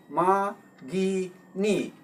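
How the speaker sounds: ꯃꯥꯒꯤꯅꯤ slowly split into three separate syllables. Shown as a demonstration. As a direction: break ꯃꯥꯒꯤꯅꯤ into three drawn-out beats, pausing between each. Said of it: "ꯈꯣꯟꯊꯣꯛꯁꯤ ꯑꯍꯨꯝꯅꯤ". (ꯃꯥꯒꯤꯅꯤ ꯈꯣꯟꯊꯣꯛ ꯑꯍꯨꯝ ꯇꯣꯉꯥꯟꯅ ꯇꯞꯅ ꯍꯥꯏꯕ)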